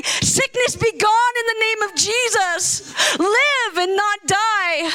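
rising bold command over the sick; commanding healing. Pretend. Preacher to a sick person, bold commanding rebuke. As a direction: speak it like you're casting out sickness.

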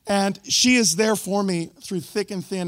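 normal talking voice

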